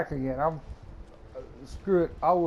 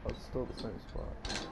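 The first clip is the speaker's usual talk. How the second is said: whispered; 4 semitones lower, 11 dB quieter than usual. Real, not acted. Disappointed, unvoiced.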